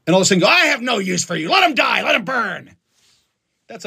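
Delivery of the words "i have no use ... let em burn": high, hammed-up condemnation; mock callous shout. Bystander condemning a stranger in a burning car, mock shout. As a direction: cry out in mock contempt.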